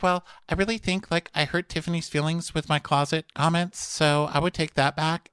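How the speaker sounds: high, prissy mock apology; a put-on sugary reality-star voice; imitating Stephanie. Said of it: "So Stephanie's like".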